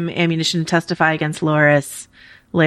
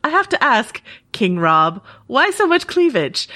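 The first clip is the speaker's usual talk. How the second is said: Nikolai Coster-Waldau; 6 semitones higher than usual. Retold or imitated. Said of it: "Nikolai Coster-Waldau goes".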